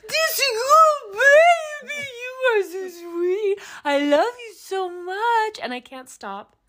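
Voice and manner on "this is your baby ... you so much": put-on high, slow, wailing baby talk; drunken gushing. Drunk woman gushing over a sweet cow, high wailing coo. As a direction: coo tearfully at a baby animal, drawn out. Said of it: "I'm drunk off my ass, and I'm going".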